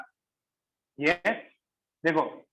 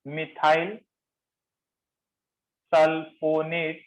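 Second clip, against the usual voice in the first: methyl, long pause, then sulfonate drawn out very slowly; slow dictation; much slower than usual. Shown as a demonstration. Teacher naming a new group for note-takers, slow dictation. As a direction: spell out the name by sound, chunk by chunk.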